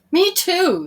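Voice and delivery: put-on eager young me too; John agreeing. A narrator's reading. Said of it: "said John".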